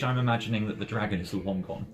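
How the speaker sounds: usual speaking voice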